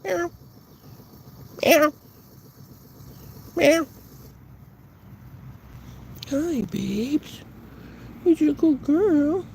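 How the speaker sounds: very high cooing pet voice. Real, not acted. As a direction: coo to the animals sweetly. Cooing at pets.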